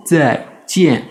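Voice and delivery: "zaijian" very slow, in two drawn-out pieces; exaggerated syllable separation. Shown as a demonstration. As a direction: stretch and split "zai" and "jian" like a beginner. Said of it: "quitte à ce que ça fasse un peu gaga". (« zaijian » très lent, syllabes séparées, un peu gaga)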